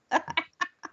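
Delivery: brief high-pitched giggle; laugh. Real, not acted. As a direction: burst into a short, high laugh. Laughs after asking Richard to speak up.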